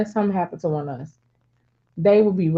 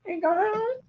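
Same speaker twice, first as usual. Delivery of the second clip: exasperated mother; 12 semitones higher than usual. Retold or imitated. Mother shakes head at girl.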